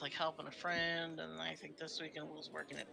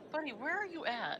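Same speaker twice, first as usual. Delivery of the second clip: talking to a pet; 11 semitones higher than usual. Real, not acted. Calling out to the dog.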